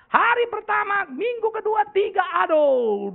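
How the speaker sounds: high, loud wail of dismay; hammed-up exasperation. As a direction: count off the awful weeks, drawing out the last. Recounts dreadful first weeks of teaching. (keluhan tinggi yang dilebih-lebihkan)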